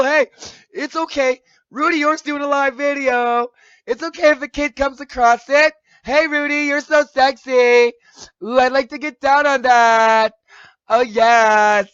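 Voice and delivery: mock his fans in a loud, high camp voice, stretching the line endings. Mocking a rival's fans cheering his live video, loud camp impression.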